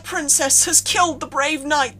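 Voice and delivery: hammed-up dying wail, high and loud; fake cry. A narrator's reading. Boy playing a slain knight, wailing a dramatic fake cry. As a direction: overplay a knight's death cry. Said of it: "wonderful fake cry".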